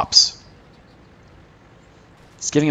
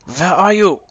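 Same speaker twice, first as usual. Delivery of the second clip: loud, high mock-Russian call; playful Russian accent, pitched up and loud; 7 semitones higher, 7 dB louder, slower than usual. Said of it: "I'm doing my Russian accent".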